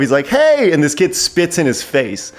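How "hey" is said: manic host greeting. Retold or imitated. Uncle Nutsy greeting kids.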